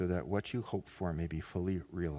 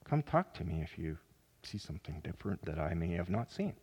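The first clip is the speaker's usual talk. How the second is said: ordinary talk, nothing marked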